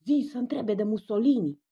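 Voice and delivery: a loud whisper that comes out as a bellow; sergeant-major stage whisper; bellowed would-be whisper. A narrator's reading. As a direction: try to whisper but boom like a sergeant major. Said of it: "Coralia mugi ca un plutonier major, gândind că șoptește".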